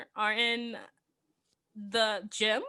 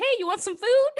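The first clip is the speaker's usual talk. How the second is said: bright, higher, louder call through the door; mock stepmom call; 10 semitones higher, 6 dB louder than usual. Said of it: "she's like".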